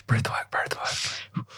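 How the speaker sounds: soft voiceless whisper; whispered aside. Real, not acted. Host coaxes the poet before her reading, in a whisper. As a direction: whisper it under the laughter.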